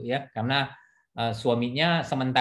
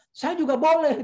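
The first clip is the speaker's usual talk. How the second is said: much higher, petulant claim; defiant quote; 14 semitones higher, 4 dB louder than usual. Retold or imitated. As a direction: insist like a stubborn wife. Quoting defiant wife. (ngotot, nada sangat tinggi)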